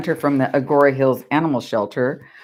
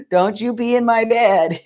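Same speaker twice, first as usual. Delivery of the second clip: a higher mock child voice, wagging a finger; little girl's warning; 6 semitones higher than usual. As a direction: imitate the daughter scolding, high and playful. Daughter warning grandma, retold.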